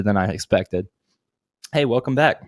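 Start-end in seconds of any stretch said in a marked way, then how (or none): none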